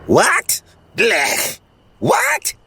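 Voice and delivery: high startled cat noises; cat squawk. Acted. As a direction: squeak it out like an indignant cat. Cat voiced, high and strange.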